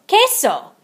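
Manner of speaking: high, loud proud cry; eager answer. A narrator's reading. Scopito answering in class, high and loud. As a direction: pop it out proudly.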